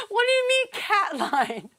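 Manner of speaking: high, amused mock disbelief; baffled local. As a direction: imitate a bewildered local asking for sense. Local baffled by cat line.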